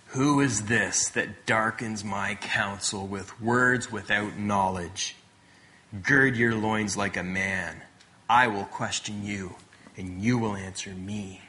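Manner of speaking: low, slow, solemn divine questioning; God addressing Job. Retold or imitated. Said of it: "stands before Job and says this".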